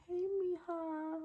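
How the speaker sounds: high, slow sugary hey mija; mock sweet neighbor saying hey mija; fake sweet greeting. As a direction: coo it with false sweetness.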